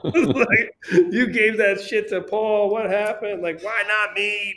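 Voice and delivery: high, slow, mock-whiny complaint with laughs; whiny middle child. Son retelling his complaint to father, hammed-up whiny and high. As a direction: play the sulking kid who feels unloved, laughing through it. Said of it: "Middle child syndrome".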